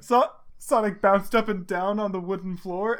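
usual speaking voice

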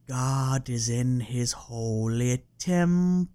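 slow, eerie preacher intoning; creepy Reverend Kane. Retold or imitated. Quoting Poltergeist two, slow creepy Reverend Kane. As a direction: draw every word out like a creepy preacher. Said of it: "super creepy, Reverend Kane".

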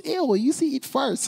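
high, squealing ew of disgust; a kid teasing a friend, mock grossed out. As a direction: pull a face and squeal it. Kid mocking friend's gross habit.

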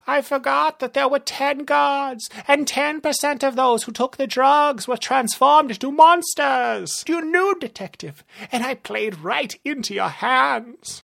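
high-pitched horrified realisation; villain in alarm, very high; panicking villain. Acted. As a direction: wail that the plan has backfired. Narrator voices Rumble panicking.

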